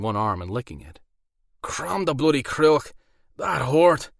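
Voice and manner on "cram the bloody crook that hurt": high, loud, slow Irish werewolf yelp of pain; thick Irish accent. A narrator's reading. Hurt werewolf cries out to his captors in a thick Irish accent. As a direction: bellow it out in a thick Irish brogue. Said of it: "he said with a thick Irish accent".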